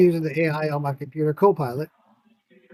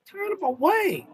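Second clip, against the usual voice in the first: much higher, slow, drawn-out away; disbelieving echo; 12 semitones higher, slower than usual. Real, not acted. Repeating cohost's words, high incredulous echo. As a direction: stretch it up in disbelief. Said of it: "Turn 'em away".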